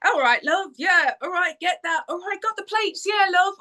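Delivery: high-pitched Cockney-style English chatter; busy English housemate banter, high voice; silly English character. Retold or imitated. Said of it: "slip into these silly English characters".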